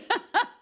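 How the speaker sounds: high burst of laughter. Real, not acted. Host laughing at the fake compliment. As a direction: laugh out at the absurdity. Laughing at her own story.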